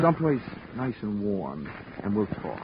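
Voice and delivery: soft, hushed coaxing; soothing. Acted. Detective gently coaxing an unstable man to come along, softly.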